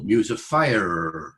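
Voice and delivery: "fire" with the R clearly sounded; a rhotic, lingering R ending "fire"; R after vowel. Shown as a demonstration. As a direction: draw out "muse of fire" slowly, voicing the final R. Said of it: "They listen to the R after the vowel".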